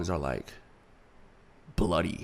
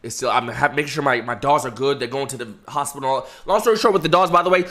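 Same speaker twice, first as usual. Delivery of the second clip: normal talking voice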